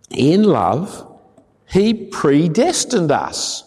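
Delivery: solemn scripture read. Acted. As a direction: read the verse with weight. Reads scripture passage aloud.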